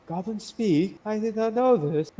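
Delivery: as if a goblin speaking were absurd; surprised high voice. Acted. Priestess startled by a talking goblin, high and surprised. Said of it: "she goes".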